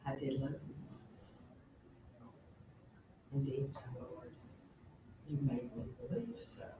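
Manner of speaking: soft, hushed lovers' exchange ending in a whisper; different tone. Acted. Hamlet and Ophelia gazing at each other, hushed. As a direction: let it fall to a whisper, tender and still. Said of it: "in a completely different tone of voice".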